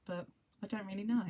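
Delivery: ordinary talk, nothing marked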